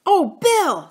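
high, loud, glad cry; joyful. A narrator's reading. Boy greets Bill as he arrives, joyful. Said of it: "said Philip joyfully".

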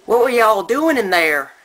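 imitated Southern father calling out loudly; American Southern accent. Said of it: "this is my best American Southern accent".

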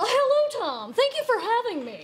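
high, sweet fake-polite guest. Playing along as a talk show guest, high and sweet. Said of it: "I'm all".

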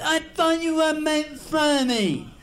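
very high, slow impeded voice; speech impediment voice. Retold or imitated. Customer complains to Marty, very high and slow. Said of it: "a little speech impediment".

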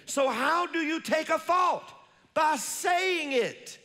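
high, loud preaching climax; preacher reaching the peak of the sermon; full-force preaching. Acted. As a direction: lift it up and drive it home.

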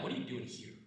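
hushed, half-whispered scold; whisper-scold a sneaking kid; hushed parent scold. Retold or imitated. Parent catches a kid out of bed, hushed scolding. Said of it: "and you're like".

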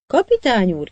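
crying out. A narrator's reading. Cabin boy running up, calling out to the captain. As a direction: call out in alarm. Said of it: "kiáltotta".

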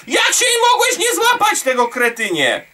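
high-pitched yelling rant; exasperated shout. Real, not acted. Gamer scolding after a missed grab, exasperated shout.

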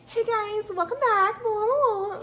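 high, bright-pitched fake intro voice; mock her old over-the-top bubbly greeting; squealing hey guys intro; bubbly old YouTuber voice. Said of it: "I would put on this, like, facade 'cause I thought I needed to be so bubbly".

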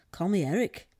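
matter-of-fact storybook reply; as natural as possible. A narrator's reading. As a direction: answer naturally, as yourself. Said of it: "Eric said naturally as he could".

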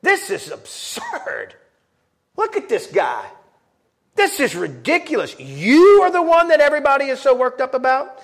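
high, mocking scorn; scornful disbelief. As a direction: sneer at how ridiculous this is. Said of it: "As if".

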